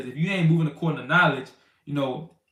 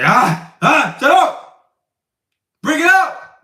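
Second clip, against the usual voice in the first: mock yell; 10 semitones higher, 8 dB louder, much slower than usual. Imitating screaming brothers.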